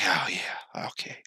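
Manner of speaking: whispered, soft giving in; meek agreement. Retold or imitated. Offered a smoke, the angry guy whispers a meek yes. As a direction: go suddenly small and compliant.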